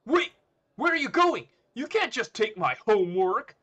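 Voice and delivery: very high, loud protest; high-pitched outraged yell; alarmed shout. Acted. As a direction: cry out after someone leaving. Student calls after homework thief.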